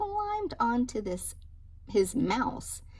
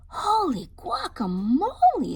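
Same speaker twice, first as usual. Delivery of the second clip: higher, louder startled exclamation; exclaiming; 9 semitones higher, 8 dB louder than usual. Acted. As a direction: blurt it out in shock. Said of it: "exclaimed Skip John Jones".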